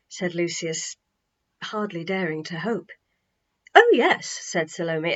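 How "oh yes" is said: high, confident "oh, yes"; settled certainty. A narrator's reading. Salome to Lucius, certain and assured. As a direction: say it as if it's all decided. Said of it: "as if it were all settled".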